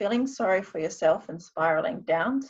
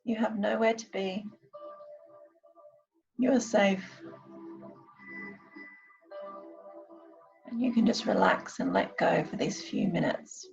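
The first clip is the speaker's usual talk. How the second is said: guided meditation; 6 semitones higher, 4 dB quieter than usual. Acted. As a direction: ease them gently into calm. I'm going to now do a short meditation.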